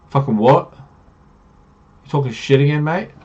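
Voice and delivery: acted scoffing questions, voice jumping up; incredulous challenge. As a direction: call out an obvious tall tale. Party disbelieves the boast.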